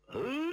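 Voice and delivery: rising, high-pitched "ahem"; throat-clearing grunt. A narrator's reading. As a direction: clear your throat to announce yourself. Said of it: "这个人弹奏了一声". (痰嗽一声)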